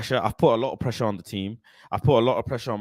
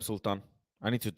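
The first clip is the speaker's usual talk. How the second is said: ordinary talk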